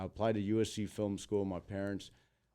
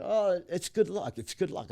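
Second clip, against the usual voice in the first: imitated chorus, high and loud then easing; mock cheery relatives, pitched up; wedding guests; 10 semitones higher, 6 dB louder than usual. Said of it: "all the agents are like".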